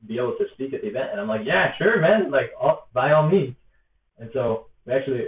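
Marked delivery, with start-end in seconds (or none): 1.4-3.5 loud, high burst of enthusiasm; eager agreement. Retold or imitated. Answering an activist's request to speak, eagerly agreeing. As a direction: jump in with a wholehearted yes.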